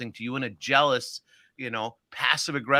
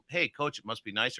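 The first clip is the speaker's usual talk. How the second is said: softer take; 3 semitones lower, 6 dB quieter than usual. Shown as a demonstration. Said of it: "You hear the difference in my voice?".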